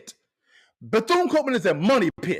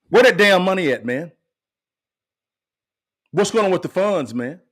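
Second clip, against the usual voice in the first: loud, indignant demand; angry outburst; 3 semitones lower, 4 dB louder than usual. Real, not acted. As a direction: demand it like you've been robbed. Outrage over missing school funds.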